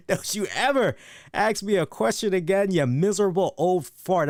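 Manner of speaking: mock Tom Latimer's English snarl; self-mocking Latimer British accent imitation; Tom Latimer impression. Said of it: "delivered those lines with that accent".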